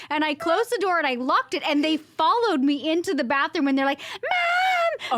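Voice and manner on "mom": very high mock child's shout; kid yelling for mother. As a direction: imitate a child hollering after a parent. Kids chasing her, calling out.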